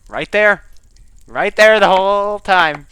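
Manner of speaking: high, loud, drawn-out outburst; loud exasperated realization, slow on the end; exasperated exclaim. Real, not acted. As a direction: throw up your hands at the obvious find. Finds the meat finally.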